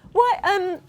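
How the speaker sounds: high, nervous and shaky, with a nervous laugh; voice shaking. A narrator's reading. Artie asks the sea monster, nervous and high. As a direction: try to hide the fear and fail. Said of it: "trying to stop his voice shaking".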